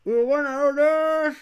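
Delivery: loud, high drumroll line; loud build-up. Acted. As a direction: announce it loud. Revelación del ganador, fuerte. (anuncio fuerte y agudo)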